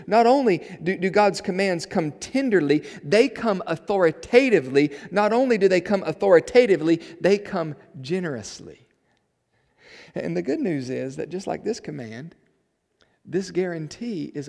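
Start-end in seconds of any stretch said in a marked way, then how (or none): 0.1-8.9 loud, high, insistent pulpit delivery; raised, emphatic sermon voice; forceful preaching. Real, not acted. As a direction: drive the point home to the congregation.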